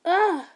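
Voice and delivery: frustrated groan. Acted. Frustrated sound.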